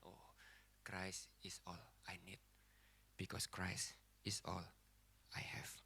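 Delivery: low, soft, solemn appeal; hushed preaching. Real, not acted. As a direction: let each phrase sink in with long pauses.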